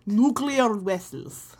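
vessels said as wessels, W for V; slow Chekhov-style nuclear wessels. Retold or imitated. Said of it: "If Star Trek has taught me anything".

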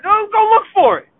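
loud, high, fed-up refusal; mock exasperated yell back at the kids; irritated yell. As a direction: shout him off, you're busy at the grill. Snapping at family while grilling.

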